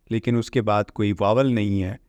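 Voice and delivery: normal talking voice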